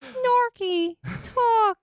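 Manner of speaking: high squeaky crying dolphin. Acted. Dolphin podcast joke.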